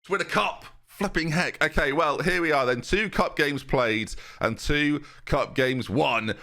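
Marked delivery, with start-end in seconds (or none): none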